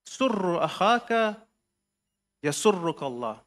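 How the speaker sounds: slow, each word set apart with long pauses; slow spaced recitation. Shown as a demonstration. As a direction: read it out slowly, pausing between phrases. Imam's letter quoted, first reading.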